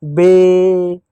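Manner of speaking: slowly stretched syllable; drawn-out demo. Shown as a demonstration. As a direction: stretch the sound out for kids. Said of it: "अब बोलो ना बे". (धीमा खिंचा उच्चारण)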